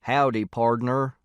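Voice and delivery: slow, drawn-out mock cowboy drawl; playful cowboy impression, words stretched; jokey cowboy greeting. A narrator's reading. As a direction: ham up a joking cowboy hello. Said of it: "which is a joke he does".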